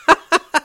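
high bright burst of laughter. A narrator's reading. Wife laughing at Lenny's machine talk. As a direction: laugh out loud, amused. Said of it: "Curly's wife laughed".

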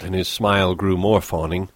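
plain reading voice

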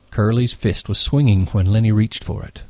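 ordinary delivery

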